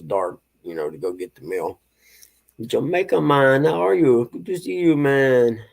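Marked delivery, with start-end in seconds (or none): 2.6-5.6 hammed-up Jamaican accent, higher and louder; bad Jamaican accent. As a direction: greet him in a deliberately bad Jamaican accent. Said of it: "It's a horrible Jamaican accent".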